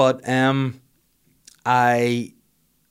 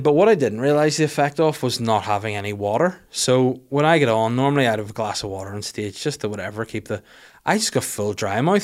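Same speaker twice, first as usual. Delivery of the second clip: normal talking voice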